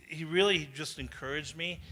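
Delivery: ordinary talk, nothing marked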